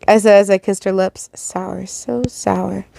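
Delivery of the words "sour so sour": very soft murmur; a hushed sour complaint; muttered. Acted. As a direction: mutter it to yourself. Character tastes lipstick, muttering.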